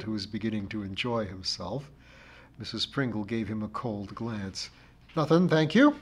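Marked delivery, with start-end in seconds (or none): 5.1-5.9 louder, lifted, haughty refusal; crushing dignity. A narrator's reading. Missus Pringle refuses the narrator's help, crushing dignity. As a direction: turn the offer down with crushing dignity. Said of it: "replied the lady with crushing dignity".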